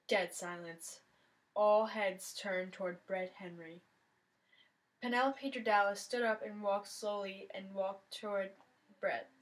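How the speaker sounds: usual speaking voice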